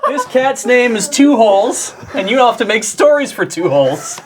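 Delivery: high, loud declaration through laughter; worked-up, high-pitched laughing protest; giddy insistence. Real, not acted. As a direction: proclaim it loudly, on the edge of laughter. Laughing defence of cat's name.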